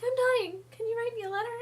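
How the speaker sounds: high, loud, mock-dramatic plea of a dying woman; dying Fosca. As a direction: squeal it out like a melodramatic invalid.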